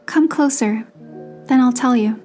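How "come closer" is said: ordinary delivery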